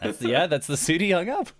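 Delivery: high, through laughter; laughing. Real, not acted. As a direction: giggle while repeating it. Laughing repeat of joke.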